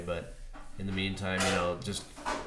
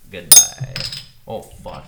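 ordinary talk, nothing marked

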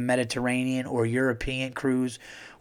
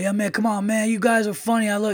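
put-on higher, louder drunk party-guy voice; drunk cruise guy; 8 semitones higher, 4 dB louder, faster than usual. Drunk guy on the boat inviting comedians over, loud and higher. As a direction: hype them up like a happy drunk.

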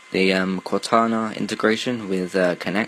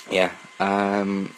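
normal talking voice

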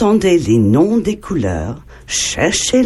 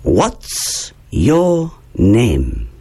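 What's your name, very slow and stretched out; slow model question; 6 semitones lower, much slower than usual. Shown as a demonstration. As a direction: drill it slowly once more. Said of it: "Et encore une fois". (très lent, pour que les enfants répètent)

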